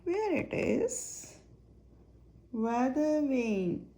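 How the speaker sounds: ordinary delivery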